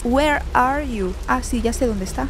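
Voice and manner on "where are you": slow, stilted machine-translator English; careful text-to-speech style questioning; Google Translate voice. Pretend. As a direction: space out each English word like translation software. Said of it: "Como Google Translate".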